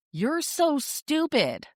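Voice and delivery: high, sneering kid voice mocking a parent; mocking child. Child's insult quoted by parent coach.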